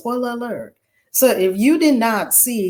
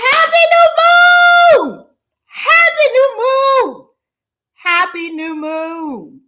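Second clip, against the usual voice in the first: high, slow, loud celebratory call, fading down; excited sing-song greeting; 14 semitones higher, 6 dB louder, slower than usual. Real, not acted. Host greeting viewers on the new moon, high excited call fading. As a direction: cry it out to the sky, then let it settle.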